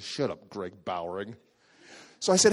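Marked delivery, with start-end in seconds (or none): 0.0-1.4 low, grumbled shut up at the rival; low sour grumble; grumbling retort. Retold or imitated. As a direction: mutter it under your breath at the show-off. Annoyed retort to rival friend.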